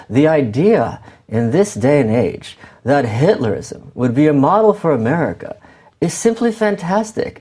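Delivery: a lightly put-on odd, unplaceable accent, quoting a puzzled man; Jared Taylor's weird accent. Author quoting Jared Taylor to the host in a mild odd accent. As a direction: retell his question in his strange accent, only half-performed. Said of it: "He's got this very weird accent".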